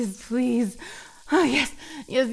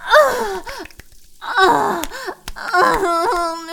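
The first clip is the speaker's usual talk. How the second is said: high, loud sobbing moans; overwhelmed open-throated cries; crying moans; 5 semitones higher, 7 dB louder, much slower than usual. Acted. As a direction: cry out in waves. Climax of intimate roleplay scene.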